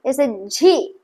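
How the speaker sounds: normal talking voice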